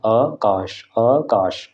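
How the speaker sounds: आकाश with the आ held for two beats; आकाश spoken with a lengthened first vowel; long vowel. Shown as a demonstration. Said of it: "आकाश दो मात्रा होती है".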